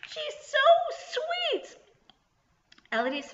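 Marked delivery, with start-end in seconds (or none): 0.1-1.8 very high, wistful goblin voice; sad voice. A narrator's reading. Goblin about the lamb he wanted, sad voice. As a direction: pine for the lamb, pitched way up. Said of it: "he said in a sad voice".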